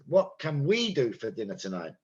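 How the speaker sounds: "we" stands out in the question; stress on "we". Shown as a demonstration. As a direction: lean on "we", keep the other words light. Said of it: "Meaning we".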